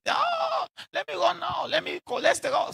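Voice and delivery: high, hurried mock panic; panicked mock runner. Speaker mocking a forty-year-old suddenly chasing health, in a high panicky voice. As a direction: squeal it like someone suddenly scared for their health.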